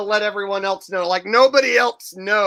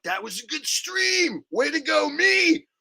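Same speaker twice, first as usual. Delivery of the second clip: higher, cheering himself on; mock self-praise; 7 semitones higher than usual. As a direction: pat yourself on the back, hammed up. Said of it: "I'm always like".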